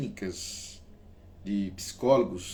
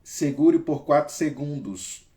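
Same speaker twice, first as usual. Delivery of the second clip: breathing coach; 3 semitones higher, 6 dB louder than usual. Shown as a demonstration. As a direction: hold them on the breath. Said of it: "guiding a breathing exercise".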